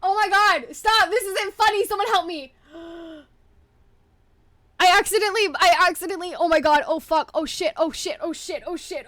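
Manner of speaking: high shrieking panic, on the edge of tears; real panic, begging for help. Real, not acted.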